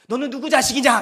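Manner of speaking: loud mock scolding; loud, huffy dad voice; proud scolding father. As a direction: playfully imitate a stern dad telling off his child. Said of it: "자존감이 높은 아저씨들 이렇게 하죠".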